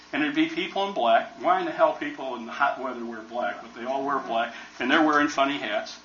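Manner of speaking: ordinary talk, nothing marked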